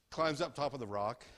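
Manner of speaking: ordinary delivery, nothing marked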